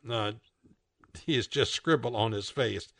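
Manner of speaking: plain reading voice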